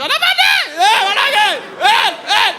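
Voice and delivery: high, loud desperate shout; shouting for help. Retold or imitated. Crowd calling the officer to the burning building, a high loud shout. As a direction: yell across the street for the officer.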